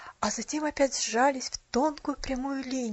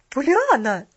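loud, higher-pitched exclamation of protest; indignant outburst; 4 semitones higher, 11 dB louder, slower than usual. A narrator's reading. Mrs. Carew protesting the child's chatter, loudly indignant. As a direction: cry the name out in outrage. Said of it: "возмутилась миссис Керрю".

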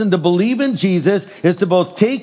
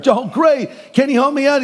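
relieved eager plea; 9 semitones higher than usual. Retold or imitated. Dangling man answers Jesus.

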